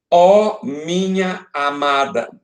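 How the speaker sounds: "Ó minha amada" slowly recited, word by word; verso recitado. Shown as a demonstration. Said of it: "Qual é a sílaba mais forte". ("Ó minha amada" recitado devagar)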